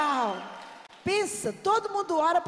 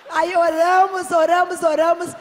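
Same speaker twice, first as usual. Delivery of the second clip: building to a shout; 5 semitones higher, 9 dB louder than usual. Real, not acted. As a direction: build it up like a preacher's climax. Building climax of story. (proclamando alto, crescendo)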